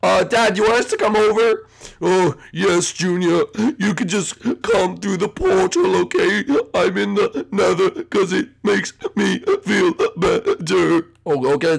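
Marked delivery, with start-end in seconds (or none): 2.0-11.1 mock sobbing, broken into gulps; upset, not calm. Dad invites Junior through the portal, mock sobbing. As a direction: choke it out word by word. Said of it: "just, just calm down".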